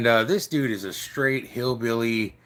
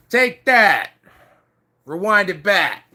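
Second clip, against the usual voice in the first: much higher and louder, hammed-up; retold punch shout; 7 semitones higher, 7 dB louder, slower than usual. Hitting the dude before he finishes, mock triumphant shout. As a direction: bark it like throwing a punch.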